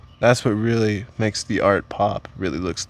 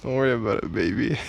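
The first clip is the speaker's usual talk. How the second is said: smooth-talking voice; 5 dB quieter, faster than usual. Acted. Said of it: "why are you talking like that".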